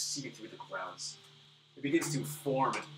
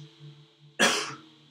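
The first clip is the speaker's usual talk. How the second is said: hacking cough; 13 dB louder than usual. Acted. Dying old man coughs.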